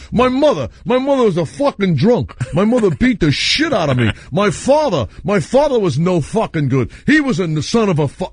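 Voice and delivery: high-pitched comic griping about a horrible life; Rodney Dangerfield. Acted. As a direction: imitate the comic railing, pitched well up. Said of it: "He talked like that about his horrible life".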